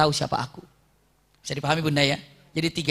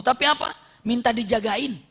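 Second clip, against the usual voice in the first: ordinary talk, nothing marked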